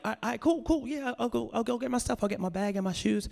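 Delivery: high-pitched, hurried compliance; acted-out nervous scrambling to oblige, voice up high; flustered agreeing. Told to leave, grabbing his stuff, flustered. Him hurrying to leave, retold.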